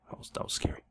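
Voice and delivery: breathless whisper. Real, not acted. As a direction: hush it like it's spooky. Aside after scary deal.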